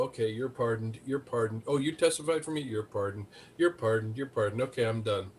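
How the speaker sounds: low, soft, offhand pardoning; bored Trump. Retold or imitated. Trump handing out pardons to witnesses, low and offhand. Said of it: "Trump's gonna go".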